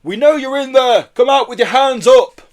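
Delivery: loud, high police command; booming loudhailer voice. A narrator's reading. Police ordering suspects out of the van, booming. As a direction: boom orders out to a whole street. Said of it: "a voice boomed over a loud hail".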